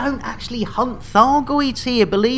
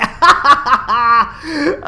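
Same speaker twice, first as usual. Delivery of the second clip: helpless laughter; 5 semitones higher than usual. Real, not acted. Still laughing at clip.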